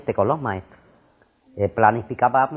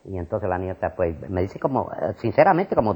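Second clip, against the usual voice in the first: ordinary talk, nothing marked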